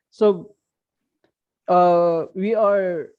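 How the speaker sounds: loud, high, halting restart. Real, not acted. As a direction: speak up so the listeners can hear. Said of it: "can you speak louder?".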